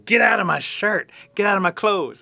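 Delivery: loud shrieking yell; girl yells at the mud dauber in her shirt, loud and frantic; screaming. Acted. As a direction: shout it as a bug crawls down your shirt. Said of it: "She screams".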